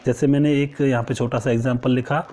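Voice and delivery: usual speaking voice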